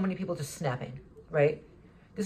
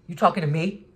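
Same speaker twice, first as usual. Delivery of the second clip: mock-aggressive challenge, louder; confrontational challenge; 5 dB louder than usual. A man squaring up to the speaker in a store, louder confrontational challenge. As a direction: throw it back like a provoked stranger. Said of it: "He's getting confrontational".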